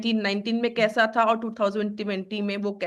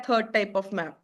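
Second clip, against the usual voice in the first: slow dictation; 3 semitones lower, 3 dB quieter, much slower than usual. Shown as a demonstration. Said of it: "teacher states the heading".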